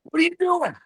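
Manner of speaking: high mock scolding coach; retold coach yelling across the field; angry coach. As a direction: imitate the annoyed coach calling out. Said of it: "the coach of the team goes".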